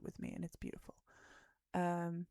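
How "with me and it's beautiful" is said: tender hushed whisper; soft whisper. Acted. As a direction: whisper it like a sweet secret. Sentimental aside about movie night.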